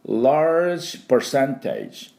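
Large percentage spoken slowly as an English model; careful slow English Large percentage; teacher's slow demo. Shown as a demonstration. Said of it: "何ていうかというと簡単です".

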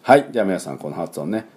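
ordinary talk, nothing marked

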